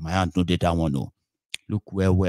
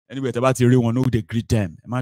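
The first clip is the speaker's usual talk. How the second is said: normal talking voice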